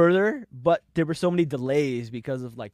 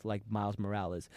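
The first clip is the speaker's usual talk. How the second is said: ordinary delivery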